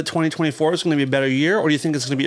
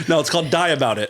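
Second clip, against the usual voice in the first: laughing; 2 semitones higher than usual. Real, not acted. Laughing at own joke.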